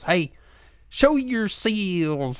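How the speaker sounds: high, drawn-out mock Southern call; hammed-up, stretched Southern movie holler; lady cop, Southern. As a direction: imitate a lady cop's bad Southern shout. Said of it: "and she goes".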